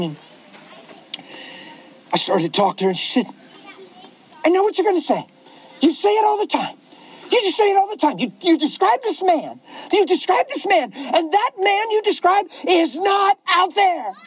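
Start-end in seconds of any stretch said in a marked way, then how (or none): 4.4-14.1 put-on higher, upset young woman's outburst; upset girl. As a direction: blurt it out like a hurt eighteen-year-old. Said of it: "She freaked out".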